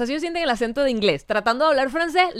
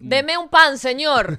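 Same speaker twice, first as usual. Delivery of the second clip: mock customer; 3 semitones higher, 6 dB louder, much slower than usual. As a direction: call out the order like a clueless tourist. Acted customer ordering bread abroad. (cliente exagerado pidiendo en voz alta)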